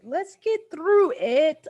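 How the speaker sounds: rallying herself. Real, not acted. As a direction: say "Let's get through it" out loud, slowly, psyching yourself up.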